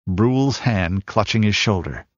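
usual speaking voice

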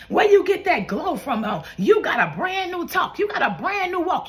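high, excited sermon cadence; fervent preaching. Real, not acted. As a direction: preach it up, voicing amazed onlookers. Preaching, voicing amazed onlookers.

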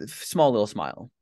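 usual speaking voice

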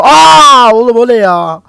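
high, loud shriek sliding into a loud panicked question; startled shout; real scared yell. Real, not acted. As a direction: yell in fright at the monster. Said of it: "korktuğumdan bağırıyorum".

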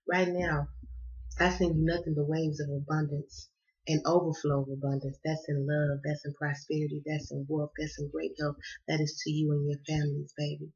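soft spiritual blessing to viewers. Acted. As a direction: bestow abundance on listeners gently.

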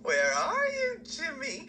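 calling out. Pretend. Calling out for a rival.

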